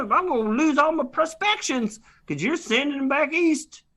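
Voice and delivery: put-on a cranky old prospector griping; cowboy prospector. S0 plays a Western prospector.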